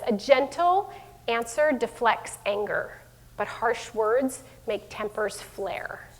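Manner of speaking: slow, quiet verse read; scripture reading. Shown as a demonstration. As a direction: read the proverb slowly and softly.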